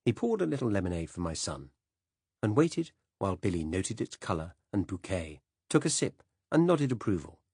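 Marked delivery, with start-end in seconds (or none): none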